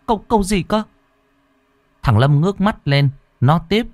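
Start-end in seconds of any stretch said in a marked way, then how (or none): 0.1-0.8 high, hesitant stammer; stammering mother. A narrator's reading. Mother caught off guard by her son, stammering high. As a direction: stumble over the words, high. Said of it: "Cô Thao ấp úng".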